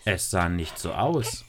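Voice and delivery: plain reading voice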